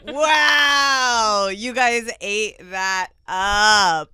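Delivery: high, loud, drawn-out cheer; excited praise. Real, not acted. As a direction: whoop and stretch out the compliment. Host cheers the performers.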